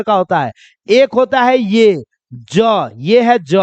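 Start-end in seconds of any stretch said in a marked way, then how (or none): 3.4-3.5 "ज" as a plain hard j; j sound. Shown as a demonstration. Teacher contrasting two letters, hard j sound. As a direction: say "ज" with a j, no buzz. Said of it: "ज के नीचे अगर ये बिंदु".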